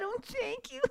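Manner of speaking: high, soft thank-you; a breathy squeaked thanks; mock surprised thanks. Retelling what he always said, soft high thanks. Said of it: "I always said".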